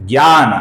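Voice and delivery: ज्ञान as "gyaan"; Hindi pronunciation. Shown as a demonstration. Teacher spelling out the Hindi way, ज्ञान as "gyaan". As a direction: start ज्ञान on a g-y sound. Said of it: "हम कैसे बोलते हैं".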